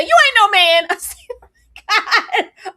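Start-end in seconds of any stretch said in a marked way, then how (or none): 0.0-0.9 mock wife retort. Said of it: "She said". 1.3-2.7 laughing disbelief. Real, not acted. Hosts reacting to wedding drama.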